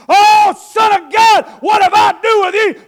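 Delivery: slow, loud, high shriek of the possessed; wild man crying out. Acted. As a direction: scream it on hands and knees.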